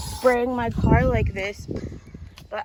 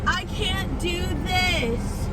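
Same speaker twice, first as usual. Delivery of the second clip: higher, slow, drawn-out whimper; near-crying whine; 7 semitones higher, slower than usual. Real, not acted. Giving up on the ant-filled car, slow whine. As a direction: drag it out, defeated and close to tears.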